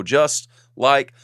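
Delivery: slow build to a high-pitched name; drumroll name reveal. Real, not acted. Host announcing a patron's shout-out, slow and high-pitched. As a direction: stretch out the big announcement.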